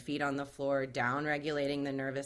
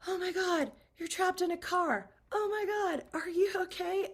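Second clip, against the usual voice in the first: high-pitched panicked bystander; freaking out. Acted. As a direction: act out a bystander freaking out, voice shooting up. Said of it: "they're freaking out".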